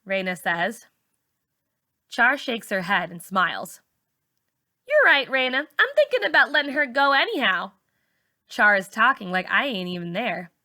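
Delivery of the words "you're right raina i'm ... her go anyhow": put-on high dismissive teen girl. A narrator's reading. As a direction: brush her off, pitched up high. Char dismissing narrator.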